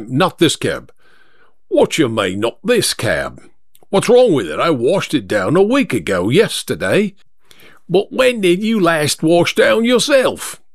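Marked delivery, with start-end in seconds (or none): 1.7-3.4 offended cabbie. Acted. Driver challenges the passenger's refusal, offended. 3.9-7.1 indignant London cab driver, swooping pitch; offended cabbie. Acted. Driver defends his freshly washed cab, indignant. 7.9-10.6 high-pitched, needling London cab driver; rival cabbie. Acted. Second driver mocks the first, needling. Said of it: "came from the driver of the second".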